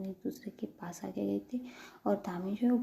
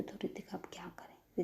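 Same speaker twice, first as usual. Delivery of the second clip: plain reading voice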